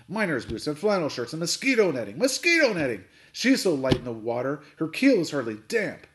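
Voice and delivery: loud indignant list, peaking on the repeat; bellowed. A narrator's reading. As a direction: bellow on, scornful of the cargo. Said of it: "Captain Swain bellowed".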